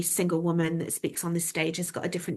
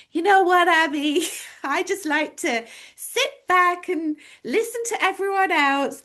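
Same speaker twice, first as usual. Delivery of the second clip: imitating a friend's Southern drawl, pitched up; 10 semitones higher than usual. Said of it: "really bad, um, uh, s-, uh, Southern drawl there".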